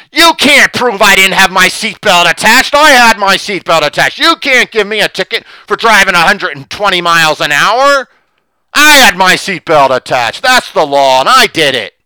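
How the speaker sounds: loud, high mock outrage; hammed-up shouted self-defence; indignant protest. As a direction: argue loudly like a pulled-over driver. Acted drunk driver arguing when stopped.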